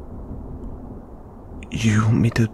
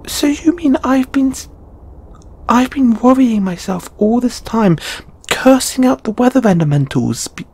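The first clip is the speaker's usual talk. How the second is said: very high-pitched, exasperated rush of protest; flustered outburst; 13 semitones higher than usual. Acted. Learning the feeling is mutual, flustered exasperated outburst. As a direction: pour it out in a squeaky high pitch.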